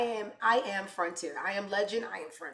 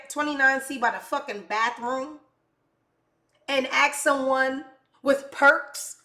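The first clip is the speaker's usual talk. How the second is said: loud, furious tirade; raised voice, swearing in outrage; angry rant; 5 semitones higher, 7 dB louder than usual. Real, not acted. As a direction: tear into the airline at full volume. Ranting at airline over seat.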